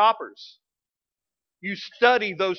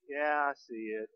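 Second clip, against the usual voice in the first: much softer, lower, deflated answer; sheepish mumble; 7 semitones lower, 13 dB quieter than usual. Retold or imitated. Husband sheepishly admitting to his wife he found it, quiet. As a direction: concede it, embarrassed and quiet. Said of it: "sheepish reply".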